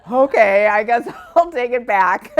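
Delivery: loud, high, laughing through the words; laughing retold quote. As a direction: give in with a big laugh. Retold comeback amid audience and own laughter.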